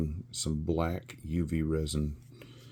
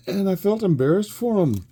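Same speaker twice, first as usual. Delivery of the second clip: laughing; 13 semitones higher, 8 dB louder than usual. Real, not acted. Still laughing over the failed whistlers.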